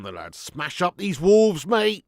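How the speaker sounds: ordinary delivery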